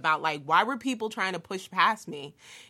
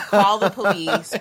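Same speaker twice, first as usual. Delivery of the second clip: loud, laughing; 10 dB louder than usual. Real, not acted. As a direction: laugh out the words. Laughing at the chaos.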